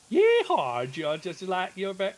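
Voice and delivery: hammed-up Southern cowboy; Texas Southern drawl. Mocking a Texan moderator with a whooped Texas drawl. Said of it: "In your deep Texas Southern drawl".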